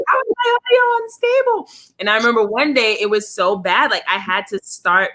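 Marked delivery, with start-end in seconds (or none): 0.1-1.7 much higher, incredulous question; exasperated disbelief. Retold or imitated. Reading the audio unstable notice, in high mock disbelief. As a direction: squeak it out in disbelief. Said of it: "And I'm like".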